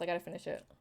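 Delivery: muffled, chewing; mouth full. Real, not acted. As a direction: mumble through the food. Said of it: "I got the salty taste of cheese in my mouth".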